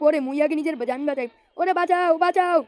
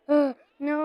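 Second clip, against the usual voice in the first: ordinary talk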